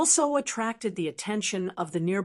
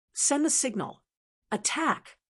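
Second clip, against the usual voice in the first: higher-pitched order with restrained excitement; excited, trembling command; 6 semitones higher than usual. A narrator's reading. Commander orders the attack signal, mildly excited and trembling. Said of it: "Commander Lung Song's voice trembled. He was excited".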